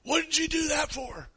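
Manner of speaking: high, sharp mock challenge from a stranger; angry stranger. Stranger confronting the hunters, high and indignant. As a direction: squeak it out like an outraged busybody.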